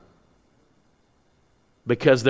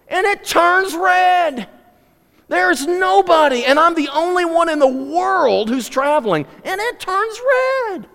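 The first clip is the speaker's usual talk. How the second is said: exasperated complaint; 7 dB louder than usual. Real, not acted. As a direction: stretch out the annoyance at the light. Exasperated story about red light.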